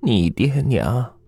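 put-on high, slow old-woman voice; drawn-out aged storyteller, pitched high; old grandmother confiding. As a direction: reveal a painful family secret slowly as granny. Voices grandmother revealing the secret.

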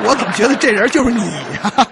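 high, loud and laughing; laughing as you accuse your partner; laughing accusation. Real, not acted. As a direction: tease him while cracking up.

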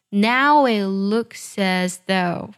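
Now it looks as though read very slowly, every word apart. Shown as a demonstration. Teacher reads the line slowly for students. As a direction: read it slowly so learners hear the linking. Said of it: "你们先听我来慢慢读一下".

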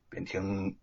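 plain reading voice

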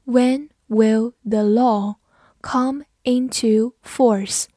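slow, word by word with pauses; slow, careful model reading; slow read-through. Shown as a demonstration. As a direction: read it slowly for learners, each word separate. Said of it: "我们慢读一遍".

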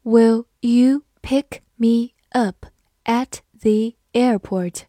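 slow, careful question; slow read-through. Shown as a demonstration. Teacher reading the airport question slowly for students. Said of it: "好，慢读一遍".

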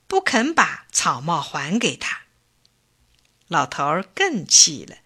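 ordinary delivery, nothing marked

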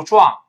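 "John" turned into Chinese "壮", w glide and ng; wrong Chinese-style John. Shown as a demonstration. Teacher warns students, loud mispronounced John as "壮". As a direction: say "壮" loudly as a mistaken "John". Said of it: "也不要读壮".

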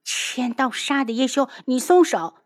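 high indignant protest; pained, cursing. Acted. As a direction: demand to be let go at once. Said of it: "他哎呦的一声".

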